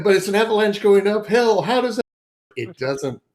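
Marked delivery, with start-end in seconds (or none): 0.0-2.0 high, loud comic protest; playful alarm, pitched way up; mock panicked bewilderment. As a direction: cry out in hammed-up confusion. Acted bewilderment at wild magic.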